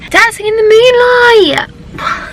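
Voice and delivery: high, loud and slow, thrilled; excited shout. Real, not acted. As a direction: belt out the song title in delight. Naming band's hit song excitedly.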